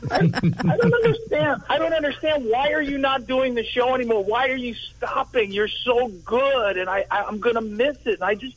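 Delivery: high, bewildered, half-laughing disbelief; can't grasp why it's ending; dumbfounded. Real, not acted. Host's show is ending.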